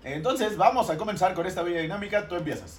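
high, loud announcer voice; game show host. Acted. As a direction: kick it off like a TV host.

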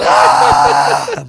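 full-throated release yell, no words; loud yell. Retold or imitated. Peeing by the road, yelling out tiredness with a loud roar. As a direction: let out a big tired roar. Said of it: "pero gritando bien duro".

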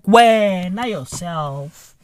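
slow taunting drawl; slow drawn-out jab. Acted. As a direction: stretch out wena yourself slowly. Repeated catchphrase jab.

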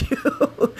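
ordinary talk

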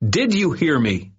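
high, pressing question; stern demand. A narrator's reading. Boy demanding the bully listen. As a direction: demand an answer.

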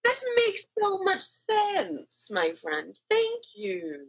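high, slow, pompous gentleman; haughty noble voice. Acted. Disguised Mister Silver thanking the arms dealer, pompous and high.

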